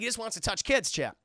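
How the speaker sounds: normal talking voice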